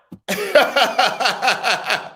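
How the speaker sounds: a long high laugh. Real, not acted. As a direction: laugh in disbelief. Laughing at the daughter.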